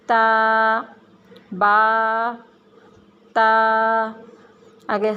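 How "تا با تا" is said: تا with the tongue tip at the tooth roots, said loud and clear; loud careful تا for the class. Shown as a demonstration. Teacher modelling تا for children, loud and clear. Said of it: "میرے ساتھ ساتھ آپ لوگ دہرائیں".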